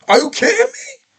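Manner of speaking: high-pitched, incredulous outburst; sudden high cry of disbelief. Real, not acted. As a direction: squeak it out in total disbelief. Ranting in disbelief.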